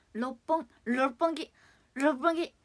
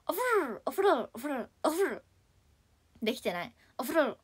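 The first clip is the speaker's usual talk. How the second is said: ordinary talk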